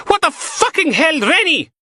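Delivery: high, loud startled yell; startled shout. A narrator's reading. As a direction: shriek it out in shock. Startled woman yells at pinch.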